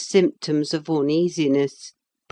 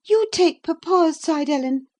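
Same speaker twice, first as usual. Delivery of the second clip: put-on girlish accusing; 12 semitones higher, 5 dB louder than usual. Cathy accusing Ellen.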